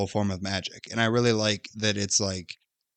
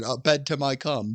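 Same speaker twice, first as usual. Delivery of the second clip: put-on street-kid voice; 4 semitones higher than usual. Shown as a demonstration. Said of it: "and I said".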